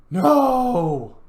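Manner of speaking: sudden loud, high cry of dismay. Real, not acted. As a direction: yell it as everything cuts out. Game suddenly crashes.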